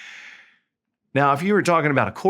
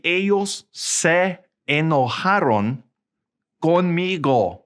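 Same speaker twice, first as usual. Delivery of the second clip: slow, higher, carefully separated Spanish; slow model sentence; 5 semitones higher, slower than usual. Shown as a demonstration. Teacher models the translation for learners, slow, word by word. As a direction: stretch each word of "ellos se enojaron conmigo".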